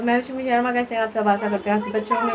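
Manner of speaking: normal talking voice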